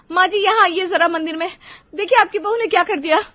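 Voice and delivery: high, loud indignant tattling; angry complaint. Acted. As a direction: summon mother-in-law to see the offence. Sister-in-law angrily complains to mother-in-law. (ग़ुस्से में ऊँची शिकायत)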